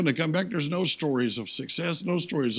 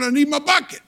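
insistent; 10 semitones higher, 4 dB louder than usual. Retold or imitated. Old man refusing Andy.

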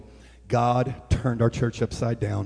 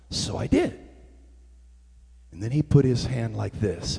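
ordinary delivery

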